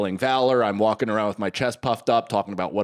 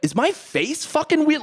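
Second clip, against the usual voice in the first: much higher, puzzled question; baffled. Retold or imitated. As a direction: ask in bewildered disbelief. Confused inner thought.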